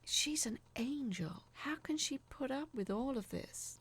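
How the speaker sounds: whispered thought; inner thought. A narrator's reading. Narrator admiring Doreen silently, whispered. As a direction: whisper it in awe to yourself. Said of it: "I thought".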